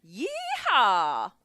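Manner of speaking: loud, high cowboy holler; cowboy yeehaw. Shown as a demonstration. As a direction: whoop it like a rodeo cowboy. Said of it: "a good yeehaw in a cowboy voice".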